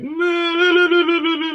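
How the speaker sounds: high, loud wobbling wail; baby cry flubbed by fingers. Shown as a demonstration. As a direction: imitate a crying baby with fingers flapping the lips. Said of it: "take two fingers and blah".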